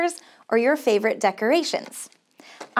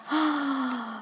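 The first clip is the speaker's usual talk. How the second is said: delighted gasp; 7 dB quieter than usual. Real, not acted. Reacting to the banjo sound.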